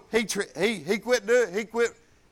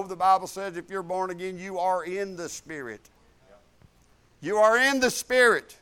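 ordinary delivery

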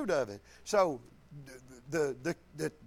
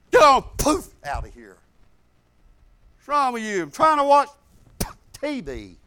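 put-on barking, much louder shout at a child; harsh parent yell; 3 semitones higher, 10 dB louder than usual. A parent yells at his kid to get out, angry. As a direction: shoo the kid off like an irritated dad.

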